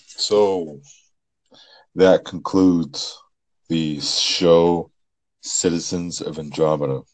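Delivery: slow, loud show-closing announcement; announcer voice. Acted. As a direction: sign off the show like a host. Formal show sign-off.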